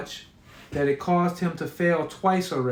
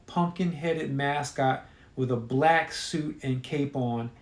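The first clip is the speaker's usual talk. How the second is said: plain reading voice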